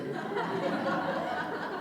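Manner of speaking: quiet unvoiced laugh; breathy chuckle at his own joke; soft laugh. Real, not acted. Speaker laughs at his own mock struggle, soft laugh.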